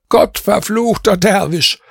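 mild hammed-up shout of anguish; outcry. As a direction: curse the dervish in despair. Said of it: "schrie ich jetzt".

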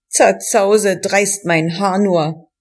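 ordinary delivery

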